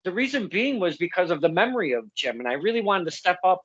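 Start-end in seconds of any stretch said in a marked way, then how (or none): none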